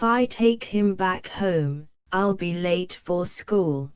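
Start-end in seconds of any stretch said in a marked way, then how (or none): none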